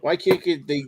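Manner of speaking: ordinary talk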